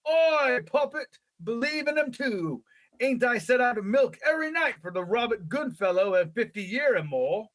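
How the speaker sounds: high, hammed-up old English shepherd; funny old-country English accent. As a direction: play a rustic English old-timer, pitched right up. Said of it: "in that funny accent that all the old people".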